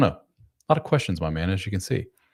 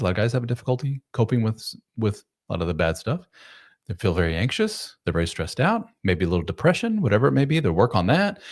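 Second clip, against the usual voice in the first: ordinary talk, nothing marked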